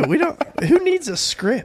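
sarcastic high voice. Pretend. Mocking their lack of prep.

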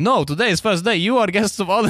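high, eager mock Russian accent; hammed-up Russian accent. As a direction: insist cheerfully as the Russian host in a Russian accent. Russian host insists.

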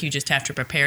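normal talking voice

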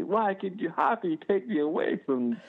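high-pitched wailing toddler, hammed up; mock crying child. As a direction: imitate a little girl's tearful protest. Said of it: "She's crying instantly".

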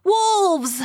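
high, loud panicked cry; terrified cry. A narrator's reading. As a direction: scream out the warning in fear. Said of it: "cried the maid".

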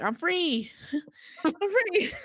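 high, laughing mock celebration; elated release. Host acting Thisbe once he gives her an out, elated.

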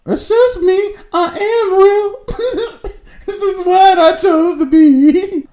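high wailing mock sob, laughing; mock crying voice. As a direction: whine it like a fake breakdown. Mocking friend's line, crying laugh.

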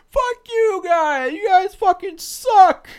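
high, slow, drawn-out bellow; drunk heckler yell. Retold or imitated. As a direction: hurl it like a drunk fan. Drunk fan flipping them off.

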